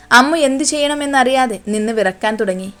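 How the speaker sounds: ordinary delivery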